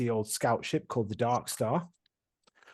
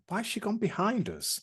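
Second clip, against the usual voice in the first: puzzled question; 10 semitones higher than usual. Retold or imitated. Retelling a player's confused question.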